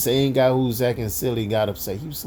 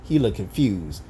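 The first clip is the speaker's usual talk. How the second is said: normal talking voice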